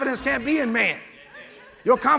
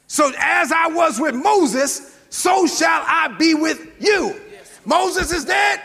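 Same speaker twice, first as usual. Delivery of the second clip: rising, shouted preaching; preaching at full force; 3 semitones higher than usual. Real, not acted. Pastor driving the point home to the church, full-force preaching. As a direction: press the congregation hard, voice climbing.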